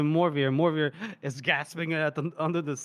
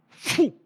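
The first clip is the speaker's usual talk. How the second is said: sharp high imitated blowpipe shot; blowdart puff; 10 semitones higher, 4 dB louder than usual. Host mimics the assassin firing his blowpipe, sharp puff. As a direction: imitate a dart puffed from a pipe. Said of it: "he aims, and he goes".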